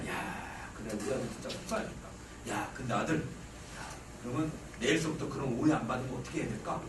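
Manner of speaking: low, soft empathetic voice; gently sympathise with your son; hushed, understanding dad acted out; soothing father. Father soothing his upset son.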